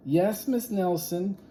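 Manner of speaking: put-on high sing-song kids' reply; kids in chorus. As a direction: chirp it like a class of kids. Kids answering teacher in chorus.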